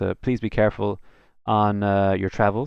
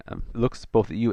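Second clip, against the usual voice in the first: normal talking voice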